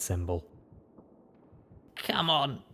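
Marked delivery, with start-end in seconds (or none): 2.0-2.6 weak hoarse rasp; raspy. Acted. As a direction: rasp it out, barely any voice. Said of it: "I rasped".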